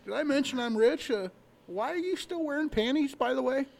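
lightly voiced braggart showing off his money; boastful rich guy.

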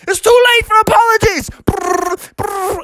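a tiny bird shouting for a fight; fighting woodpecker. Acted. The woodpecker starts pecking Bubby, shouting.

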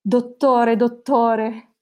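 hammed-up ward-filling shout; mock patient's yell. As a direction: call out urgently, playfully overdone. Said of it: "tutto il reparto di urli riempiva".

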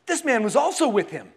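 pointed accusation. Acted. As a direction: point him out for all to hear. Servant girl accuses Peter in reading.

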